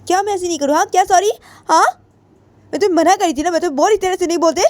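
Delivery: high-pitched cross retort; angry scolding. Acted. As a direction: reject the apology in a high voice. Angry friend rejects apology. (ऊँची आवाज़ में नाराज़गी)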